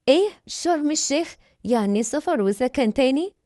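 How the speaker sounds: high, startled question; surprised. Acted. Wife hears of her husband's transfer, surprised. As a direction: pitch up in disbelief.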